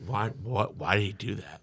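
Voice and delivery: low, hushed disbelief; bewildered. Retold or imitated. Teller wondering at the stranger's pat, bewildered. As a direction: ask it quietly, baffled.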